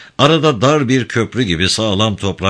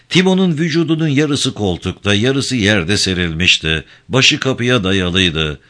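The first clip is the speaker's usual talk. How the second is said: ordinary delivery, nothing marked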